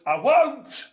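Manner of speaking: sharp high put-on voice; recognisable impression. Mimicking a famous voice for the co-host, pitched high. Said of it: "And you'll recognise this voice".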